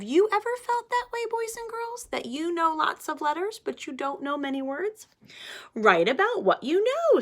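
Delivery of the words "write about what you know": big sister voice. Acted. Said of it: "says his sister".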